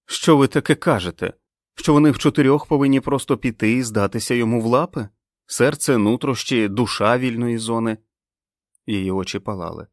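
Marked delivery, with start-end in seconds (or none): 0.0-8.0 raised, higher outraged protest; indignant outburst. Acted. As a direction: challenge her in disbelief and fury. Fran protests angrily at Abigail. (обурено, підвищеним голосом)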